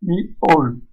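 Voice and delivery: "mi, ol" as two separate beats; syllable-by-syllable "mi, ol"; split into syllables. Shown as a demonstration. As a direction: pause between "mi" and "ol". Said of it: "plusieurs syllabes".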